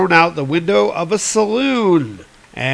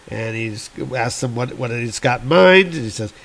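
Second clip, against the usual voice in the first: normal talking voice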